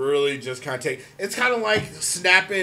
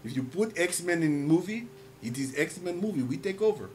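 put-on Russian accent; 2 semitones lower, 7 dB quieter than usual. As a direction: lecture him gravely in a thick Russian accent. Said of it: "That's a good Russian voice".

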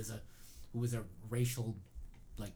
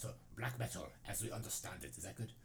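fake Norwegian accent; 4 semitones lower, 7 dB quieter than usual. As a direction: read it in a put-on Norwegian accent. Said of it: "make a fake Norwegian accent".

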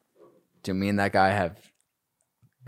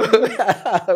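a high, loud burst of laughter; 14 semitones higher, 9 dB louder than usual. Real, not acted. After retelling the fight, laughing high.

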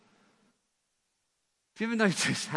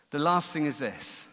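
ordinary delivery

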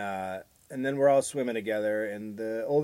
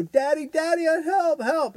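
put-on much higher, pleading little girl voice; imitated crying girl; 5 dB louder than usual. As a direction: whimper for Daddy. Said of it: "she'd start crying".

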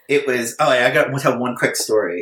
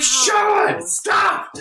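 mock shout. Retold outburst at long email.

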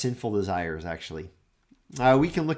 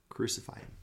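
whispered, much softer; whispered echo; 12 dB quieter, faster than usual. Acted. Preacher echoing the crowd's cry, whispered. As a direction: breathe it out in grief.

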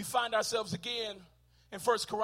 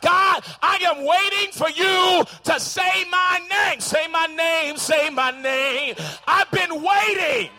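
higher, louder, slow pleading shout; pleading cry; 7 semitones higher, 10 dB louder, slower than usual. Pretend. Believer crying out to God, slow loud plea. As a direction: beg God to call your name.

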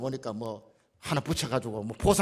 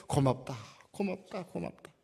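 fading thanks; 5 dB quieter than usual. Retold or imitated. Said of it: "울면서".

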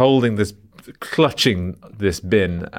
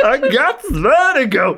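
high, loud, drawn-out American wail; hammed-up screamed impression; mock seasick shout; 5 dB louder than usual. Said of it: "she'd just go".

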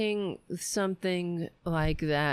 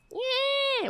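high-pitched mock screech of a yell. Podcaster imitates the yelling politician in a photo, mocking high yell. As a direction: yelp it out long and high, making fun of her. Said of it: "what is she fucking yelling about".

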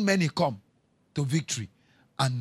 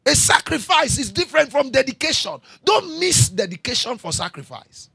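higher and louder teaching cadence; emphatic sermon teaching; preaching; 8 semitones higher, 6 dB louder than usual. Acted. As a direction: drive the distinction home. Preacher explaining with force.

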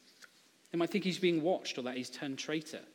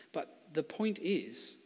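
ordinary talk

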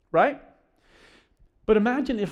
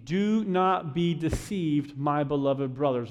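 slow, measured verse reading; solemn and unhurried, falling at the end; scripture reading; 4 semitones lower, slower than usual. Real, not acted. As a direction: read it out deliberately, word by word. Reads the verse, then paraphrases it.

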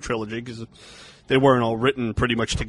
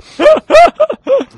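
shrieking laugh; 14 dB louder than usual. Real, not acted. Bursting out after the bit.

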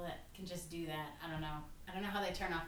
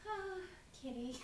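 much higher, doting coo; cooing at a cat; 13 semitones higher, 4 dB quieter than usual. Retold or imitated. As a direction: coo it like you've just spotted your own cat. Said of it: "I was just like".

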